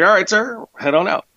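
high, cheery officer voice; friendly agent. Retold or imitated. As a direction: wave him through brightly. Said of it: "he was like".

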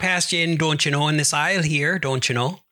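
hammed-up Wisconsin accent, lilting tag. Co-host plays Wisconsinite sneaking past in aisle, mock Wisconsin accent. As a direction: put on a folksy Midwestern accent. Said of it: "This Wisconsin's way over the top".

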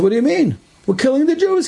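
high-pitched retold retort; arguing back. SS men answering the officer, high-pitched argumentative retort. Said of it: "They had a whole argument".

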